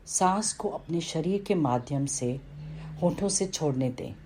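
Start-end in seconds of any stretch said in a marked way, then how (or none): none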